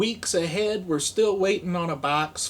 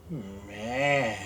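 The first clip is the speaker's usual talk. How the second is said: ordinary talk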